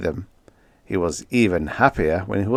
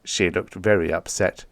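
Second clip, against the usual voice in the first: usual speaking voice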